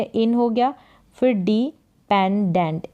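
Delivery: "इन", then "डिपेंडेंट" slowly in separate chunks; broken into parts. Shown as a demonstration. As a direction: split it as in, di, pen-dent with gaps. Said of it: "इस तरीके से break करके". ("इन" और "डिपेंडेंट" टुकड़ों में, धीरे)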